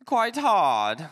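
put-on a light jokey British accent; mock British accent. After praising British accents, a playful mock British accent. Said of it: "Oh, British accent".

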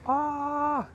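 sudden high "ah"; high-pitched cry. Real, not acted. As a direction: let out a held, very high yelp. Sudden cry during livestream work.

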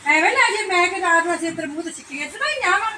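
high, loud exasperated scolding; annoyed outburst. Acted. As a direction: snap at the nosy neighbor. Annoyed outburst at nosy neighbor.

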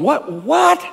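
high, rising double-take; baffled, voice climbing on the repeat; onlookers' disbelief. Acted. Onlookers peering into the furnace, high disbelief. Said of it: "everybody's looking in going".

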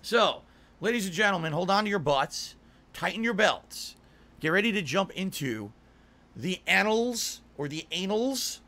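slow, showy hype announcer; announcer intro. Acted. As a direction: build it up like a ring announcer. Hypes the stream like an announcer.

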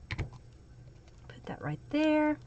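whisper. Real, not acted. Crafter murmurs to herself.

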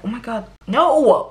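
hammed-up objection, leaning on the "no"; mock exasperation. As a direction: protest loudly with playful outrage. Acted exasperated reply to audiobook voice.

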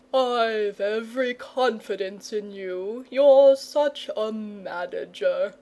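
slow, easygoing dragon voice; coaxing lazy dragon. Acted. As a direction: flatter the boy and shoo him off. Dragon flatters and dismisses the boy.

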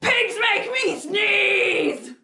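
high, drawn-out distressed shout; upset yell. Acted. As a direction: yell it slowly, acting very upset. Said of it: "Gerald is yelling. He's very loud".

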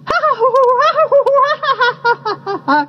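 high, rolling kookaburra cackle; kookaburra laugh. A narrator's reading. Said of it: "the kookaburras were laughing".

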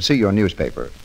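usual speaking voice

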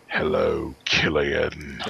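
slow and menacing, like a horror icon greeting a fan; the actor slipping into his horror-villain voice for a kid; imitating Pinhead. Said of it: "Greetings from hell".

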